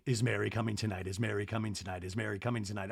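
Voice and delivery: low, soft repeats fading away; eerie séance-like echo, chanted quickly and quietly; imitated echo. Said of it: "And we hear echoing".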